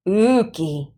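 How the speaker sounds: put-on nose-wrinkling "Ooky" in a boy's voice; disgusted. A narrator's reading. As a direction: say it like something smells bad. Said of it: "he commented, wrinkling up his nose".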